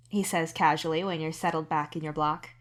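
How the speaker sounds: ordinary talk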